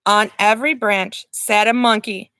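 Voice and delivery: ordinary delivery, nothing marked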